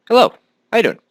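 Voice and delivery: chirpy high greeting; squeaky hello. Acted. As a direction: pop up high to greet viewers. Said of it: "high greeting to viewers".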